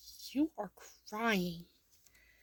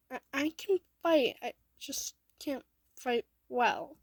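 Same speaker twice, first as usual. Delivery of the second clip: put-on higher, louder protest of a teased child; defensive protest; 5 semitones higher, 7 dB louder than usual. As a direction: blurt it out in self-defence, voice raised. Teased child defends self in story.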